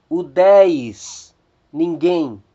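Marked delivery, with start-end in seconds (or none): 0.1-1.3 "udez" with a clear stressed "dez"; Greek diphthong demo. Shown as a demonstration. Teacher modelling the Greek word for students, stressing "dez". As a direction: sound out "udez", hitting the end. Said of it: "o ditongo ei".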